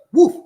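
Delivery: high, loud yelped bark; loud mock woof. Streamer yelping a loud woof mid-chant.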